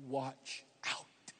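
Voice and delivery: low, hushed caution; whispered warning. Real, not acted. Preacher warning listeners who don't feel loved, whispered. Warning after voicing the objectors.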